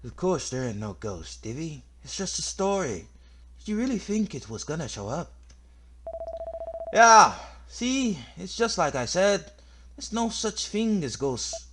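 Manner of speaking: high-pitched, dismissive rascal; cocky scoffer. Acted. Reassuring Divvy there's no ghost, cocky and dismissive. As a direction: scoff at the idea in a high put-on voice.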